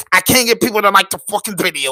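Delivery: usual speaking voice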